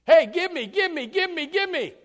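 loud, high, grasping begging; greedy demand. Acted.

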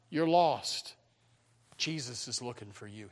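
ordinary talk, nothing marked

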